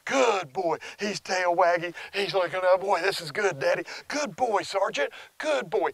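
high, affectionate praise for the dog; loving reward voice. Shown as a demonstration. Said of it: "I'm gonna be going".

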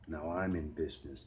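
plain reading voice

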